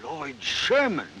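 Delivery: slow, halting disbelief; stunned realization. Acted. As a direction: let the name sink in slowly. Grandfather realises who the child is.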